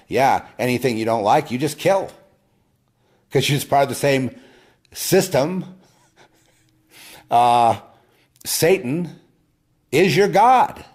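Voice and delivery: building from loud to a high, full-force shout; rising denunciation. Real, not acted. Denouncing his accusers, building.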